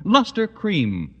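usual speaking voice

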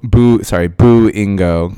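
playful ghost boo, drawn out; spooky boo. Pretend. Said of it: "'cause it's, like, scary".

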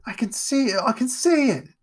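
high, excited yelps of joy; joyful yelp. Acted. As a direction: cry out with sudden hope. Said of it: "He yelped joyfully".

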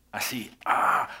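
unvoiced gasp as if struck; breathy chest-beat gasp. Preacher imitates the crowd beating their chests, gasping. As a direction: let out a voiceless "¡ah!" of grief.

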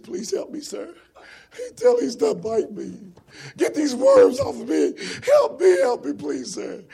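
high, frantic begging; acted cry for rescue, swelling then fading; desperate pleading. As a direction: beg like a tormented soul. Tormented soul in hell begs for rescue.

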